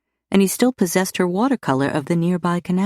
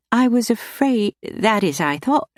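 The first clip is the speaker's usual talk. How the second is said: relieved, flustered; 6 semitones higher than usual. A narrator's reading. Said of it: "vastly relieved".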